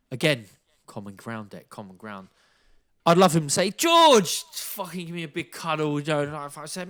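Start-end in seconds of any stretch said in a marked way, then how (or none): 3.7-6.0 high excited mock greeting; hoped-for line. Boxer imagining Canelo greeting him, squealing with delight. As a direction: gush it out like a fan's dream hug.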